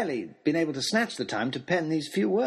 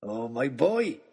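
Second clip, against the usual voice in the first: put-on booming, delighted old giant greeting the boys; a big jovial old man, bursting with joy; jolly giant man; 4 semitones lower, slower than usual. Christmas man voiced in story.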